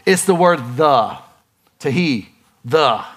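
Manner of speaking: "the" said slowly, on its own; stress on THE. Shown as a demonstration. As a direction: set "the" apart, slow. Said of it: "one word that is a radical word".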